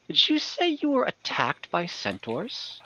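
a hint of a slow, puzzled questioner; Lucky's question. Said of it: "and you say".

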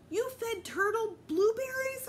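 high, loud, slow incredulous question; can't believe what he's hearing; shocked disbelief. A narrator's reading. Peter learning Fudge fed the dog blueberries, high and incredulous.

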